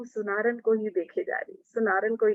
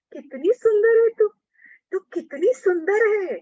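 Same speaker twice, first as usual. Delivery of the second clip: much higher, louder in delight; enraptured; 12 semitones higher, 6 dB louder than usual. A narrator's reading. Soar up with delight. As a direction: Radha gushing over the goldsmith woman's beauty, high and thrilled. Radha enraptured by the goldsmith's face.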